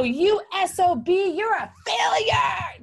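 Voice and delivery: high, loud yelled insult; mock yell at a failing player; mock angry shout. Fan heckling a player, mock angry shout. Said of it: "Do we go".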